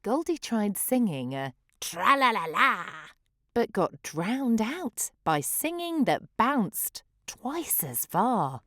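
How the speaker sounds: ordinary delivery, nothing marked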